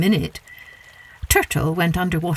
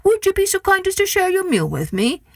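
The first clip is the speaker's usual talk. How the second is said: trickster tale voices; 7 semitones higher than usual. Acted. Turtle asks to share meal.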